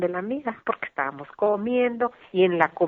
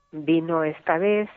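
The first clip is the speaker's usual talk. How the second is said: ordinary talk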